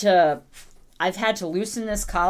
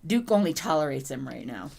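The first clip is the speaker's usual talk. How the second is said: ordinary talk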